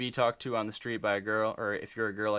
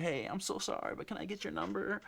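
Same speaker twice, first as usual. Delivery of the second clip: much higher, thin nervous voice asking for a number; mock meek pickup line; 10 semitones higher, 5 dB quieter than usual. Guy timidly asking a girl for her number, much higher and softer. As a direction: squeak it out like a shy guy. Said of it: "if you're like".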